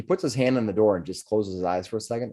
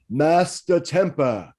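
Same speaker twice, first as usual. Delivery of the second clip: slow, high bellow; 6 semitones higher, 3 dB louder, much slower than usual. Acted. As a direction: bellow the name out slowly. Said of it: "bellowing out".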